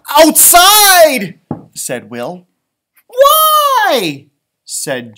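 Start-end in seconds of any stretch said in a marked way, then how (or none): 0.1-1.4 high, loud cry of outside; excited shout. Acted. 3.1-4.3 very high, loud squeak of a question; squeaky why. Acted. Jill asks why.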